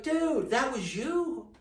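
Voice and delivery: high, loud, slow astonished question; amazed disbelief. Retold or imitated. Narrator to old man, realizing he was there, amazed. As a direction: drag it out in disbelief. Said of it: "It's like".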